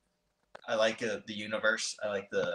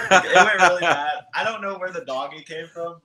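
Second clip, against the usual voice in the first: laughing; 7 semitones higher, 9 dB louder than usual. Real, not acted. Laughing at his own attempt.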